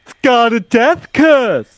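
slow ominous raspy drawl; high, slow doom-laden warning; Crazy Ralph voice. Retold or imitated. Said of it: "You gotta do the line".

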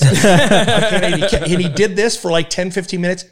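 can barely get it out for laughing; laughing hard. Real, not acted. Comic recalling the headliner's bit, laughing hard. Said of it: "I was in tears, laughing my ass off".

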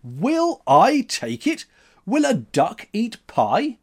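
high, loud, slow delighted retort; elated. A narrator's reading. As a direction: burst out with joy. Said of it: "His face was elate".

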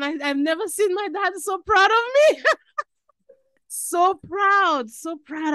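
excitedly proud memory; proud, gushing. Real, not acted. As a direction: beam with pride as you say it. Dad proud of her.

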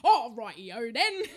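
hammed-up mock cockney, slow and loud; a put-on Mary Poppins chimney sweep cockney. Mock cockney to tease the co-host, hammed up. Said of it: "Oh, that accent".

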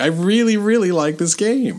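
high, eager praise; gushing. Real, not acted. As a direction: gush about it. Gushing about game.

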